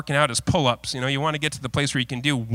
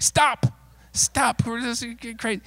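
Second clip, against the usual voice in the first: mock protest; 9 semitones higher, slower than usual. Pastor protests friend's comment.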